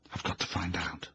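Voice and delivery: breathless secret whisper to herself; hushed whisper from the shadows; whispered thought. A narrator's reading. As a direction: hide and whisper it. Inner thought in hiding.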